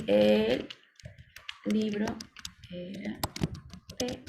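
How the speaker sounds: halting, spaced-out dictation; slow dictation. Shown as a demonstration. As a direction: dictate word by word while writing. Retyping the example word by word.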